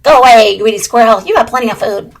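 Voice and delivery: loud, firm, shooing a thief; stern loud command. Acted. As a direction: chasse l'écureuil d'une voix forte. Blue Jay confronts squirrel.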